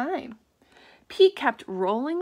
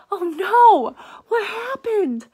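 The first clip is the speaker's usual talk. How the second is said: alarmed cry; 10 semitones higher, 11 dB louder, slower than usual. Acted. Cat falls, reader alarmed.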